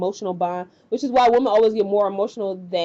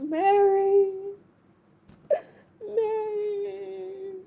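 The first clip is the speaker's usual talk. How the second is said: high, drawn-out mock wail, fading softer; heartbroken; 10 semitones higher, 5 dB quieter, much slower than usual. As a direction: imitate a heartbroken lover's long, high call. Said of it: "he's just all heartbroken".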